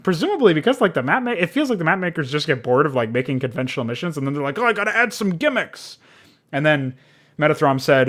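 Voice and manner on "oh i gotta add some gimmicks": higher-pitched, put-on mapmaker voice; mock eager mapmaker. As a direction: imitate an over-keen mapmaker in a raised voice. Mimics bored map makers.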